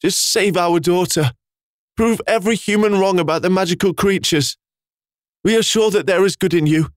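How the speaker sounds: high, grieving desperate plea; father pleading for his daughter; broken voice. A narrator's reading. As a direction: plead through a cracking voice. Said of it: "Clef said with a broken voice".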